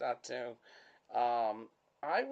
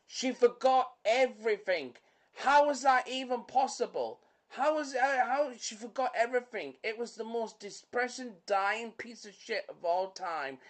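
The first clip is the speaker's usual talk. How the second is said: higher-pitched, exasperated film rant; worked up and baffled, voice pitched up; incredulous rant; 6 semitones higher than usual. Real, not acted. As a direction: protest the plot in comic disbelief. Mock outrage over a film's plot.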